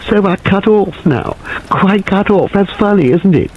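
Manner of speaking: half laughing at the danger; near-hysterical. A narrator's reading. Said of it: "shrill, almost hysterical laugh".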